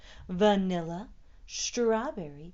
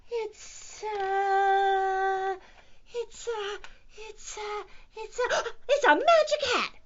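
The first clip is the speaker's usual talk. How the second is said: very high, halting, then a gasp of discovery; squeaky cat hunting for an idea; squeaky stalling; 12 semitones higher, 6 dB louder, slower than usual. A narrator's reading. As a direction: stall on squeaky uhs, then burst out loudly. Cat improvising an excuse.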